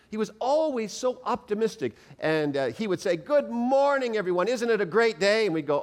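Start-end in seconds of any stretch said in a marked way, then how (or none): 3.2-5.5 lightly played cheerful Fred; cheery Fred greeting. Meat manager greeting tired night crew, cheerful. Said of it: "Fred was always so happy".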